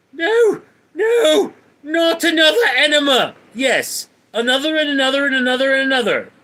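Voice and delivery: desperate cartoon squeal, fast at the end; squealing protest. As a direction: squeal it in a panic, then rattle it off fast. Pleading over another enema.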